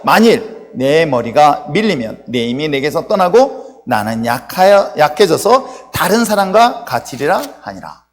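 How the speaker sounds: loud, slow, deliberate recitation; scripture reading. A narrator's reading. Preacher reads Samson's confession with congregation, proclaiming. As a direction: proclaim each phrase for a congregation.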